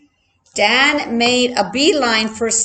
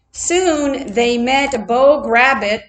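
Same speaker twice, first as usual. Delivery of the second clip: plain reading voice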